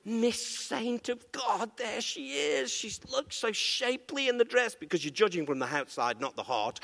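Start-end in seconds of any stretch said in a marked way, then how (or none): 0.0-4.7 soft, higher, slow admiring murmur; admiring thought. Acted. Churchgoer admires a woman in a dress softly. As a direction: gaze across and hush your admiration. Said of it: "looking over and thinking".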